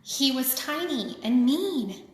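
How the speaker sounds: ordinary delivery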